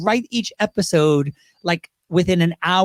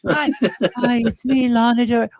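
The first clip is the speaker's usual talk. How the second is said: lightly put-on higher celebrity voice; a touch of a starlet caller, pitched up; 4 semitones higher than usual. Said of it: "they would call up [name] and they'd say".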